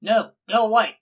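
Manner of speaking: high, loud shout to drive someone off; hostile yell. Acted. Game master as cornered woman to intruders, hostile yell. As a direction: shoo them away at full voice.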